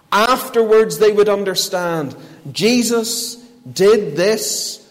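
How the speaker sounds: high, loud, emphatic pulpit voice; ringing sermon climax; impassioned preaching. Real, not acted. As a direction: proclaim it forcefully to the congregation. Preacher peaks before closing.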